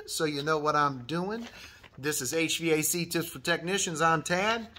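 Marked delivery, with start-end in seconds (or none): none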